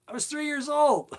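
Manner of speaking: high, loud amused disbelief; amused squeal. Real, not acted. Telling listeners about the outhouse, high amused squeal. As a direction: squeak it out, tickled by the memory. Laughing at his age then.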